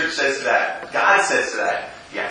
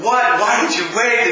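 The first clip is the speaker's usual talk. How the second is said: upset disbelief; 7 semitones higher than usual. Retold or imitated. Mom upset at the news.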